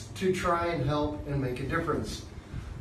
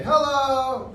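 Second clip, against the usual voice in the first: students' hello; 9 semitones higher, 6 dB louder than usual. Retold or imitated. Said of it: "and they say".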